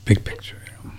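big picture whispered under breath; a voiceless half-whisper agreeing; whispered aside. Real, not acted. Co-host's low aside.

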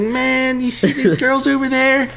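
high, conspiratorial clerk voice; imitating clerk. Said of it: "He's like".

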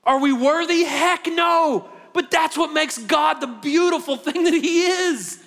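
loud, high, impassioned sermon; fiery preaching. Acted. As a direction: preach it out to the whole room. Preaching at full force.

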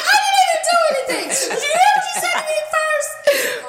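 high, loud, fast laughing protest. Retold or imitated. Little sister protesting to her big sister, loud and laughing. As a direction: plead your innocence through laughter.

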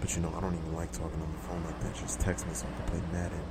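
sexy voice. Pretend. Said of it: "talk in this sexy voice".

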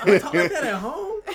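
loud, high, amused disbelief; incredulous question. Retold or imitated. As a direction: ask it loudly, half laughing at yourself. Retelling shock at own accent.

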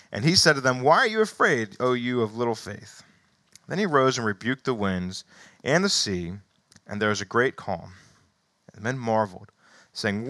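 animated scripture reading. Acted. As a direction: read the passage to the congregation. Reads Gospel passage aloud.

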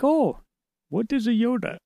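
high, puzzled voice; mock-innocent question; imagined clueless teacher.

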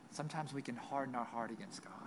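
hushed, grave whisper; a soft whispered warning. Real, not acted. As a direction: breathe it, almost no voice. Solemn point after scripture.

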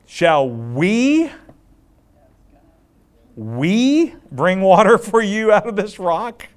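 high, loud, slow mocking question; sarcastic Moses. Moses mocking the people who want water, high and loud. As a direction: sneer it down at the crowd. Preacher voicing angry Moses.

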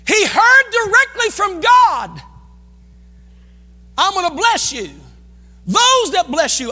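high, loud preacher's shout; full-force preaching. Real, not acted. Pastor declaring God's blessing to the congregation, full-force preaching.

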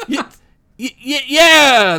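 slow stammered unsure agreement; confused crowd half-agreeing, stammering; hesitant crowd. Acted. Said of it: "the crowd said again".

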